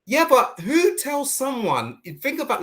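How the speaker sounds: very high-pitched disbelief; incredulous. Real, not acted. Host questioning absurd medical advice, incredulous. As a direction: push it way up, dragging the question out.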